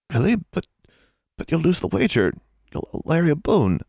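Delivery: lightly voiced worried girl; young girl's voice. A narrator's reading. Sick Anna frets to Alexandra, anxious. Anna's hopeful anxious reply.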